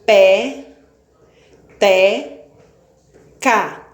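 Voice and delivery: "Pé, te, ca" sílabas destacadas, com pausas; silabando. Shown as a demonstration. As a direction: bata cada sílaba de peteca. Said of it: "Eu abri a boca três vezes". (separando sílabas para a turma)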